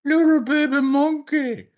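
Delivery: put-on very high, cooing baby talk to a baby monkey; high, loud cooing baby talk; cooing lion. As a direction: coo it sweetly like a lion charmed by a baby. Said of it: "a lion sees a baby monkey and they go".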